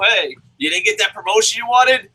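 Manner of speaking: ordinary delivery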